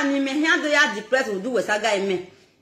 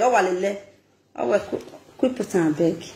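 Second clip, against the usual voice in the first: usual speaking voice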